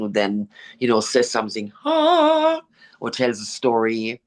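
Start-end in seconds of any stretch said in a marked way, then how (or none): 1.8-2.6 mock realization. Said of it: "imitating listener's reaction".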